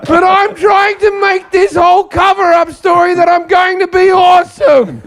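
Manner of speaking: high, loud, laughing rant; excited shouting. Real, not acted. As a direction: shout it out in disbelief.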